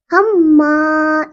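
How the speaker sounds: मा with the a stretched very slowly, one alif long. Shown as a demonstration. Teacher stretches the vowel to show its correct length. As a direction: draw out the a in मा. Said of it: "एक अलिफ के बराबर लंबा करेंगे".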